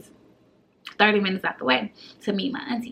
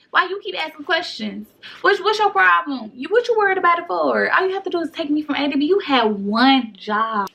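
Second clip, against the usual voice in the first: high, louder, fed-up tirade; exasperated scolding; 6 semitones higher, 3 dB louder than usual. Retold or imitated. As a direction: chew out the auntie who blew it. Scolding the blabbing auntie.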